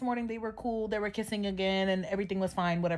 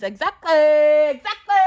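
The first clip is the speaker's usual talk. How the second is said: slow, loud drawn-out "Exactly"; emphatic agreement; 3 semitones higher, 10 dB louder, slower than usual. Real, not acted. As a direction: lean hard on agreeing.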